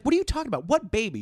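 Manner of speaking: high-pitched incredulous questioning; baffled disbelief. Retold or imitated. David hearing his baby is dying, acted bewilderment. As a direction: act out a bewildered man demanding answers. Retold baffled reply to shocking news.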